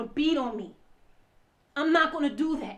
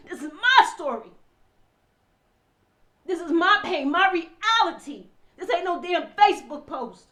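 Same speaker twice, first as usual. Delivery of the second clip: loud, raised, insistent declarations; impassioned anger; 4 semitones higher, 7 dB louder than usual. Real, not acted. Wife to onlookers claiming her own story, loud indignation. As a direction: hammer each claim harder at full voice.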